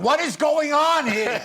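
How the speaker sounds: loud, high, slow exasperated yell; hammed-up aggravated shout; cranky outburst. Shown as a demonstration. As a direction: blow up in cranky disbelief.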